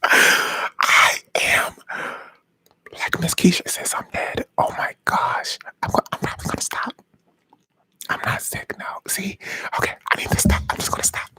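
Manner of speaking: amused, unvoiced whisper. Real, not acted. Admitting to viewers the bit must stop, whispering. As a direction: whisper as if about to give up. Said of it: "Should I stop whispering?".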